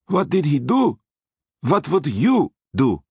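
menacing Austrian driver, high; threatening Austrian voice; Austrian accent. A narrator's reading. Hector the driver speaking.

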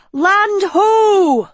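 high, loud call; crying out. A narrator's reading. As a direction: shout it out across the deck. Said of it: "she cried".

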